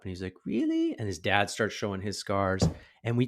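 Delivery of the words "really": put-on very high child's question; tiny high-pitched rising query; hopeful little boy. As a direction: imitate a kid perking up. Child's doubtful reply, repeated.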